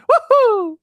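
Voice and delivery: very high, loud mascot whoop; Charles Martinet as Mario. Acted. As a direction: whoop triumphantly like a cartoon plumber. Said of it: "that was Charles Martinet's version".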